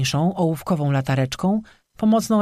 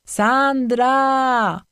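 higher, louder call from far off in the woods; distant male call; 8 semitones higher, 3 dB louder than usual. A narrator's reading. Boyfriend calls Sandra's name from the woods. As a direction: call her name from somewhere below.

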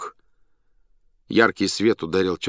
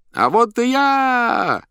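very high, slow, loud stage cry; loud clown shout; 6 dB louder, much slower than usual. A narrator's reading. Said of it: "громко крикнул хозяин". (громкий клоунский выкрик)

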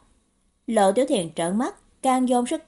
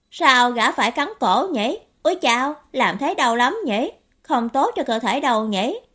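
louder, higher, wondering questions; puzzled youngster full of curiosity; naive curious youth; 3 semitones higher, 4 dB louder than usual. Acted. (thiếu niên ngây ngô, tò mò hỏi dồn)

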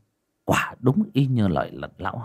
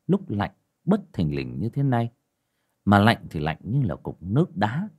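ordinary delivery

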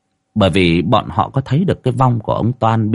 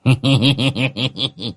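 long, tittering hee-hee; giggling chuckle; 4 semitones higher than usual. A narrator's reading. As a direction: snicker in a rapid string. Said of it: "cười hì hì".

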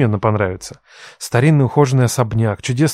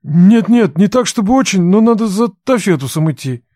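higher, louder awkward apology; hurried, embarrassed excuses; flustered young man; 7 semitones higher, 4 dB louder than usual. Acted.

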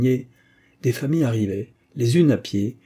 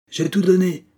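high, loud mechanic's cry; shouted reply; 9 semitones higher, 6 dB louder than usual. A narrator's reading. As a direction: shout back from the engine room. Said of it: "cria le mécanicien". (réponse criée)

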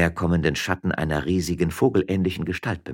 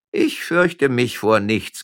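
slow, loud, higher-pitched boast; cold-blooded bravado; 7 semitones higher, 8 dB louder, much slower than usual. Acted. Policeman answers the miller's taunt, cold-blooded. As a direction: draw the words out boldly and loudly. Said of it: "antwortete Tonuelo gewissenlos und kaltblütig".

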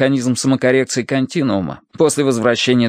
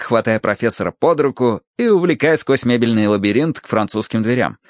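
usual speaking voice

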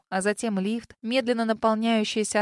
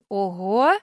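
louder breathy surprise; sincere amazement; 5 dB louder than usual. A narrator's reading. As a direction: gasp in real amazement. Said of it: "с искренним изумлением выдохнула я". (искреннее изумление)

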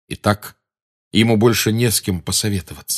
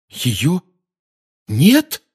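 shocked disbelief; 9 semitones higher, 3 dB louder than usual. A narrator's reading. Harry shocked.

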